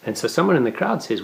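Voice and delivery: ordinary talk, nothing marked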